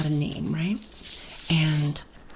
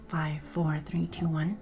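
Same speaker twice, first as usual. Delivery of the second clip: ASMR whisper; 5 dB quieter, slower than usual. Real, not acted. Whispered countdown in ASMR mic.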